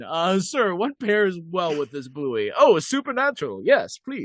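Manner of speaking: high-pitched mock fancy restaurant voice; fine-wine diner. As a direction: play a connoisseur asking for a pairing, high and hammed-up. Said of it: "like it's a fine wine".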